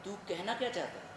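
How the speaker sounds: soft, held-back challenge; restrained question. Acted. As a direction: ask it quietly, holding back. First asking, held back.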